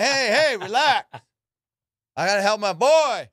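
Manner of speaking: loud, high-pitched mock telling-off; retold calling out. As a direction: call across the gym, acting out exasperation. Retells calling out to staring friend.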